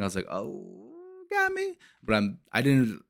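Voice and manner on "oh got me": hushed "oh" breaking into a high squeak; mock tearful groan. Movie fan reliving the mother's wailing scene, mock tearful. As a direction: act out getting choked up, half in jest. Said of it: "how she be crying and, and wailing".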